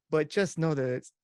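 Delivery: ordinary talk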